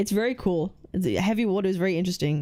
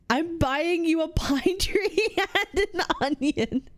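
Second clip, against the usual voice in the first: slow, high, helpless laughter through the words; laughing while reading; 8 semitones higher, slower than usual. Real, not acted. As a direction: crack up mid-sentence and squeak out the rest. Cracks up reading chat message.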